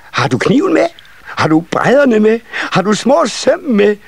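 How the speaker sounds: put-on very high child voice, shouted questions; shrieking children. A narrator's reading. Nisse children besieging the carpenter, high excited shrieking. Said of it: "de skreg og råbte".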